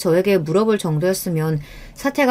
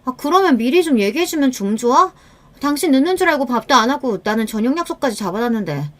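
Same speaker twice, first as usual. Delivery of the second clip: higher-pitched, annoyed complaint; irritated wife; 5 semitones higher than usual. Acted. Wife scolding husband over his cancelled dinner, irritated. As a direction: nag with exasperation. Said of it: "이게 이렇게 짜증낼 일이야".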